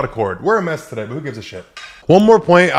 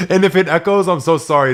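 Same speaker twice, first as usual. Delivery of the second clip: ordinary delivery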